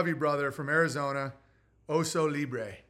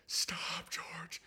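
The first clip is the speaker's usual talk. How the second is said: weary whisper to the dog; 10 dB quieter than usual. Retold or imitated. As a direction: breathe it out, defeated. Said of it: "I'm just like".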